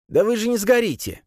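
high, gleeful character voice; tittering priest. A narrator's reading. Priest brushes off fears of burning, tittering high. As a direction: say it high, as if amused. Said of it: "захихикал жрец".

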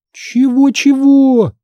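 high, drawn-out incredulous question; astonished. A narrator's reading. Girl hearing her friend's awful news, astonished. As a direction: stretch it out in wide-eyed disbelief. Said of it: "выпучила на меня глаза Ханна".

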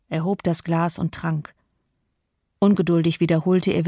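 usual speaking voice

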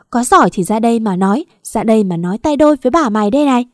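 loud, challenging tirade at the neighbours; loud cursing. Acted. Wife curses unseen gossiping neighbours over the fence, loud cursing. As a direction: hurl abuse skyward, speeding up on the dare. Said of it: "chĩa miệng lên trời chửi".

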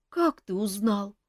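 slow, mildly tearful question; a touch of held-back crying; asked almost in tears; near tears. A narrator's reading. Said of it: "чуть не плача спросил Мафей".

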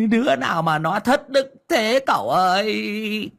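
a medium clinging to his master's leg, bawling; wailing, bawling. Acted. Ông Đồng clings to cậu Tẩy's leg, wailing high. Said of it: "mếu máo mà khóc òa lên".

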